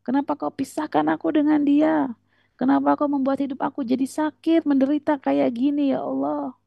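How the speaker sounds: high, plaintive plea to God; mock tearful lament. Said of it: "lalu kita menangis".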